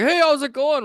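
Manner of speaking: a chirpy high hello; high friendly greeting. Retold or imitated. Gus pulling up in his boat, greeting high and loud.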